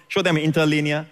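ordinary talk, nothing marked

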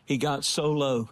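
low-pitched, measured testimony with long pauses; sober sermon storytelling. Real, not acted. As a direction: tell the story gravely, pausing between phrases. Tells illustrative story in sermon.

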